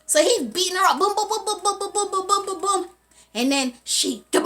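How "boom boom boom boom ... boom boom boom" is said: high, rapid-fire string of booms; imitated punching. Storyteller showing him beating her up, imitated punches. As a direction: rattle off blows on one high note.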